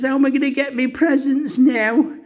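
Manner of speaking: high-pitched mock tearful child; imitated whiny crying-child voice; Kevin crying. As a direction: wail it like an upset little kid. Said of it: "and then Kevin's crying".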